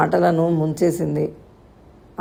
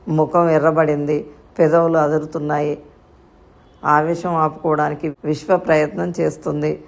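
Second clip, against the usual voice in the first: ordinary delivery, nothing marked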